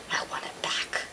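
very soft, whimpering whisper; sulky whisper. Acted. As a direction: whisper it like a sulking child. Sulking over the lost machine.

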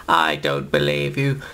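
low villain voice, rising at the end; disbelieving tyrant growl; deep doubting Davros. Acted. Davros doubts the Doctor, low and suspicious.

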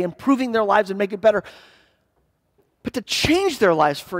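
high, loud, climbing altar-call plea; preaching at full force. Acted. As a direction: build the appeal to a ringing peak.

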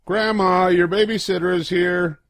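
high, loud happy call to the door; shout. Acted. Child greets grandma at the door with a loud high call.

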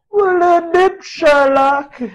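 high, drawn-out mocking jab; mock yourself in a high, slow voice; mocking sarcasm. Sarcastically answers own obvious remark.

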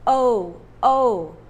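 O called out loud; loud sound model. Shown as a demonstration. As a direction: call out the O sound. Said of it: "have the O B O copy sound".

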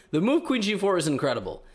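ordinary delivery

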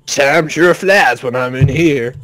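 slow Texan drawl; Texan accent. Acted. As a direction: play an easygoing Texan guy. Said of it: "it's a Texan guy".

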